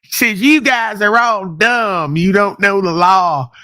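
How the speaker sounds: exaggerated high mocking imitation, dragged out; mocking Barry voice. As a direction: squeak it out slowly to ridicule him. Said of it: "He goes".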